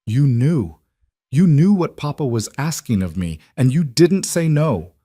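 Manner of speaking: hurt, angry accusation. Acted. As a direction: accuse her mother, slowing at the end. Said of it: "anger and hurt and betrayal all tangled together".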